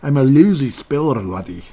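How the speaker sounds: a quick Scottish miser bark, ending on laddie; a hammed-up Scottish accent, dropping on laddie; Scrooge McDuck. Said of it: "somebody imitating Scrooge McDuck".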